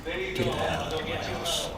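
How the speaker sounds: very soft, intense near-whisper; quiet intensity. Shown as a demonstration. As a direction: say it quietly with anger held inside. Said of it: "say it with a quiet intensity".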